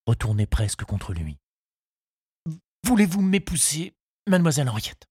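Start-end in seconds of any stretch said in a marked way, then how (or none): 2.8-5.1 high, earnest suitor's voice; nervous proposal. A narrator's reading. Charles asks Henriette to marry him, nervously. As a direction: propose high, easing down at the end.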